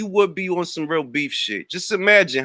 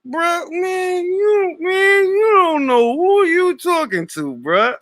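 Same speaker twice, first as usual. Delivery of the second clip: high, strained hammed-up street kid voice; mock street kid; 13 semitones higher, slower than usual. Mocking a troll by playing a street kid, hammed-up. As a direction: overdo a tough street kid, squeaky and cracking. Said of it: "I'm trying to sound like a street kid".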